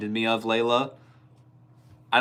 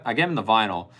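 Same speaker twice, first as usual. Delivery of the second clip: ordinary delivery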